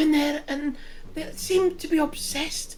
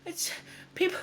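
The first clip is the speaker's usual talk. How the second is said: put-on light Scottish accent, a worried village mother; worried mum in a mild Scottish accent; 4 semitones higher than usual. Villager woman telling travellers in a lightly Scottish accent. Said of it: "I'm still Scottish".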